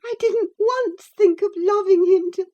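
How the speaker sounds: high, loud tearful protest; sobbing. A narrator's reading. Cathy sobs out her protest to Ellen. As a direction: plead through sobs. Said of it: "sobbed Cathy".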